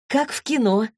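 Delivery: high-pitched delight; thrilled exclamation. A narrator's reading. Daughter delights in the getaway, exclaiming in excitement. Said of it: "воскликнула Василиса".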